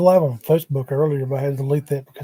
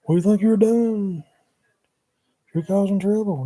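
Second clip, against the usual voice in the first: higher, indignant accusing voice; imitating an outraged onlooker, pitched up; mock scolding; 6 semitones higher than usual. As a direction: play the scolding critic, pitched up. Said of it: "everybody sees that".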